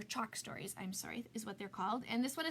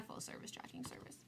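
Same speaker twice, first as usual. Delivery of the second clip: playful stage whisper; conspiratorial whisper to the audience; whispered aside; 12 dB quieter than usual. Acted. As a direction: whisper it like a secret joke. Joking aside to viewers.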